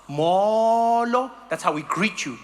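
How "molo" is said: "Molo" with a long, drawn-out, wide-open vowel; open-mouthed Xhosa greeting. Shown as a demonstration. Showing how warm-climate Xhosa people greet, open-mouthed "Molo". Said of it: "we open our mouths".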